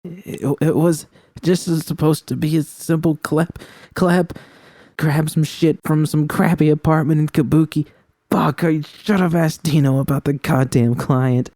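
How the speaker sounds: high, stammering, tearful street woman; rattled and hurting, pushing through; shaken young woman. Acted. Voicing distressed character V.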